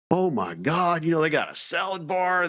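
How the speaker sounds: high, wondering rundown of the food bars; excited soldiers. Retold or imitated. Soldiers walking into the Air Force chow hall, high excited wonder. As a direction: list the treats like kids in a candy store. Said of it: "they're like".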